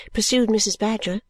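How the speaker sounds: usual speaking voice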